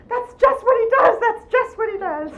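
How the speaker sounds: very loud, high-pitched outburst; wife's exclamation. Retold or imitated. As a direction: shriek it like an exasperated wife proving her point. Wife's laughing outburst, retold.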